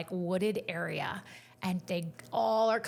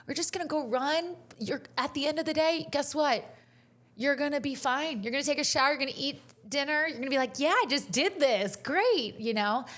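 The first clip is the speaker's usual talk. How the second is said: higher-pitched, upbeat coach pumping up the runners; retold pep talk; 6 semitones higher, faster than usual. Coach to scared kids before the race, encouraging. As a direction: pump up a nervous group, pitched up. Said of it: "trying to pump them up".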